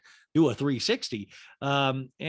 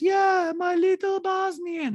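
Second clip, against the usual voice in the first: very high, loud, stretched squeal; drawn-out caricature of a doting mother; Diana impression; 6 dB louder, slower than usual. Mother gushes over riding daughter, high-pitched mock squeal. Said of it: "And Diana goes".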